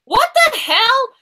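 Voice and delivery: high, slow stunned outburst; startled what the hell. Real, not acted. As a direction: blurt it out in disbelief. Host startled by guess.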